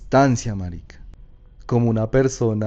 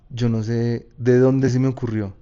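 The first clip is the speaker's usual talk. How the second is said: ordinary delivery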